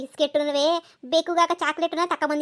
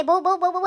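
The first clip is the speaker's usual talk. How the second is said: barking; 4 semitones higher, slower than usual. Retold or imitated. Imitating puppy barking.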